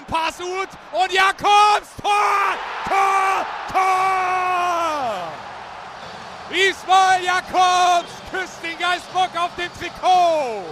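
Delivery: yelling the goal call; roaring Tor over the crowd; screaming goal commentary. Real, not acted. Radio goal call, fourth goal.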